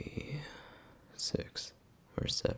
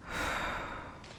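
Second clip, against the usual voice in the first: sigh; 6 dB quieter than usual. Acted. Weary pause before scolding.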